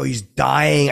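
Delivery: loud, insistent cry; kinda loud. Retold or imitated. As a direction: push it out loud so the room hears. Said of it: "I said it kinda loud".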